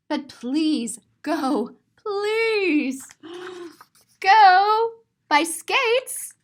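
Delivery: high, loud drawn-out plea; pleading. Acted. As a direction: beg him, stretching it slowly. Pleading with Marvin.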